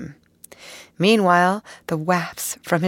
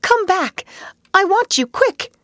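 high, loud urgent calls; excited calling; 13 semitones higher, 5 dB louder than usual. Acted. Mole calling Ratty back down the road, high and urgent. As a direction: call him back, eager and urgent. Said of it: "he called".

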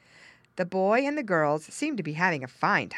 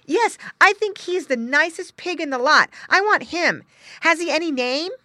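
put-on high, loud child voice; excited boy; 12 semitones higher, 9 dB louder than usual. Boy choosing his pig, excited. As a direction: gush like a boy set on his choice.

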